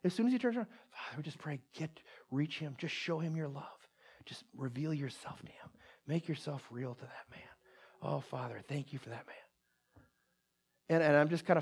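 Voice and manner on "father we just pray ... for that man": hushed, earnest whisper to God; whispered prayer. Retold or imitated. Evangelist praying for the man who snubbed him, whispered. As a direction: pray under your breath, much softer. Acts out friend praying under breath.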